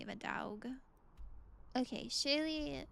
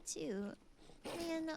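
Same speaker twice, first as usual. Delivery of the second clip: ordinary delivery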